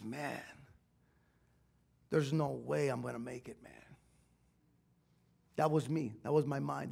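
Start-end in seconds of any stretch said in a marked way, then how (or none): 0.0-3.7 low, quiet despairing mutter to himself; a man at the altar quietly giving up; hopeless inner voice. Retold or imitated. Remembering his hopeless thought at the altar, said softly.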